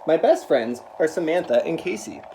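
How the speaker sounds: usual speaking voice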